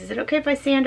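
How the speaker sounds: usual speaking voice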